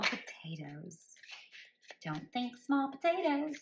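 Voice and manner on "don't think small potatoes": playful high spirit voice; spirit's voice. Retold or imitated. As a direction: repeat it in the voice spirit used. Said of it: "That's how they said it".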